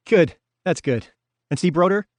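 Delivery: nervous cheer covering worry; eager Hoff. A narrator's reading. Hoff checking with Billy on the building manager, bright and nervous.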